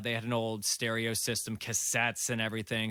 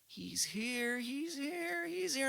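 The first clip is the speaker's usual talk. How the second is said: much higher, breathy-soft mimicry of the girlfriend; girlfriend gone wild; 13 semitones higher, 6 dB quieter, much slower than usual. Retelling the girlfriend's strange outburst to a friend, high eerie mimicry. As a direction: voice her as if something has taken hold of her. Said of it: "She gets all wild".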